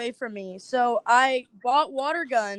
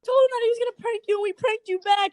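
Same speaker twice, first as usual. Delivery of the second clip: high, rushed mock gloat; 10 semitones higher, faster than usual. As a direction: blurt the payback, fast and pleased. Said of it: "and he's like".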